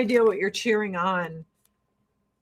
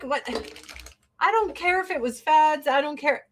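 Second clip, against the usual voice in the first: ordinary talk, nothing marked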